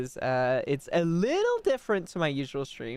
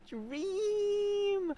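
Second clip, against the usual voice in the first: cute anime streamer; 5 dB quieter than usual. Acted. Cute high greeting.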